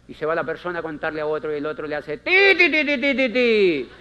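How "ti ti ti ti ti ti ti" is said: imitated beeping.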